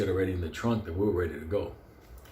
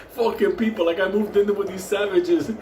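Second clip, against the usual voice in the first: high, loud griping; mock exasperation; 9 semitones higher, 5 dB louder than usual. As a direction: complain loudly as a fed-up out-of-towner. Joking complaint about noise outside.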